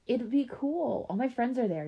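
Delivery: coaxing. A narrator's reading. Keisha coaxes her little sister toward the park, persuasive. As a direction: talk her into it.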